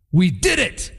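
proud soldier cheering his win; mock proud cheer; lightly voiced boastful soldier; triumphant brag. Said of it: "gone home to their wives and said".

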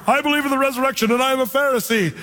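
high, fast acted Paul blurting it out; Paul's bold claim. Preacher acts out Paul stirring up the council, high and fast. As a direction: toss the grenade into the room, quick and high. Paul throws resurrection grenade.

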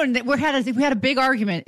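usual speaking voice

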